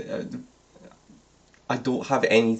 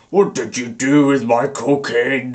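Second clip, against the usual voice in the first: a hammed-up villain roar, a bit louder; Voldemort roaring; 6 dB louder than usual. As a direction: bellow it as a mock-furious dark lord. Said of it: "he roared".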